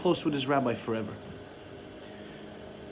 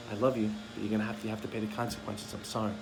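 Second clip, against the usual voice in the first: lower, softer, quick and calm; gentle response; 6 semitones lower, 5 dB quieter, faster than usual. Shown as a demonstration. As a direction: soothe rather than scold.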